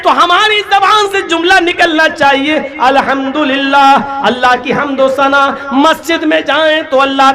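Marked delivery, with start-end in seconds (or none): none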